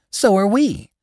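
very high, indignant agreement. A narrator's reading. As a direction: chime in indignantly, pitch right up. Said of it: "in slightly raised voices".

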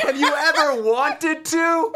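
high, slow, drawn-out mock call; insistent come-on. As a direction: imitate a suitor calling after someone, stretching each word. Man calls proposition after fleeing teller.

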